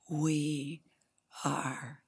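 very spaced-out, unhurried words; grounded collective declaration. Retold or imitated. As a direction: say it calmly with long pauses between words. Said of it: "takes a breath and grounds themselves".

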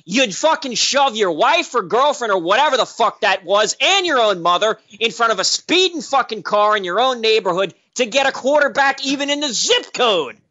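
ordinary talk

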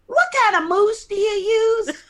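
high-pitched imitation of a country star. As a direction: put on a high, squeaky star's voice. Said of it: "She says".

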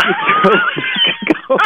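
high squeal through laughter; real laughter breaking the words; laughing. Real, not acted. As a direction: try to talk while cracking up.

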